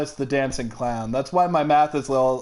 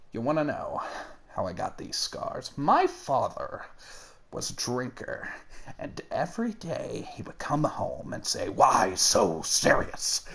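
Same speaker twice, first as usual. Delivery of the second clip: low, half-whispered, menacing Joker; Heath Ledger's Joker; 4 semitones lower, 6 dB quieter than usual. Shown as a demonstration. Asked for a Joker impression, low whispered menace. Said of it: "decent at Heath Ledger's".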